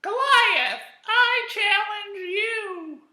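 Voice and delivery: very high, loud, slow squeaky challenge; a tiny boy shouting his challenge; squeaky brave kid calling out; bold little David. Retold or imitated. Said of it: "and he's like".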